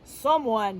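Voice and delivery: low, loud growl; Papa Bear. Acted. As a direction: growl like a big angry bear. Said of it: "he growled".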